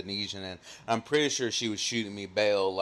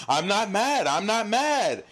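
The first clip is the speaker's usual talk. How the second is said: higher, insistent "not mad" in imitation of the coworker; mock defensive denial; 10 semitones higher, slower than usual. Imitating coworker Sandy insisting he's not mad, high and defensive. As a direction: play him protesting too much. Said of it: "He goes".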